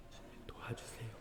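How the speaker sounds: "주님이 도와주세요" whispered, no voice at all; whispered echo. Real, not acted. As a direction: breathe "주님이 도와주세요" as a hushed aside. Whispered repeat of prior line. ("주님이 도와주세요"를 속삭이듯 소리 없이)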